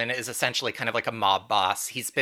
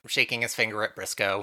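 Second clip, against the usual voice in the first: ordinary talk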